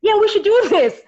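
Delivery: high, loud eager yes; eager agreement. Retold or imitated. Girlfriend agreeing to film together, eager. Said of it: "she was like".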